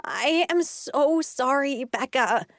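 apologize, horrified at yourself; a touch of stricken remorse; aghast apology. A narrator's reading. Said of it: "her face drained of color".

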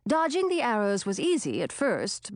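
ordinary delivery, nothing marked

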